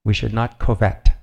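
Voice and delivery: covet pronounced "koh-vet", with a long O; Kaylin. Retold or imitated. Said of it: "I just love the way she says that".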